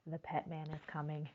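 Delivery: soft, fast, hushed warning. Acted. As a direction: lean in and warn them quietly. Ominous aside to children.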